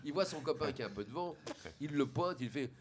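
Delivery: ordinary delivery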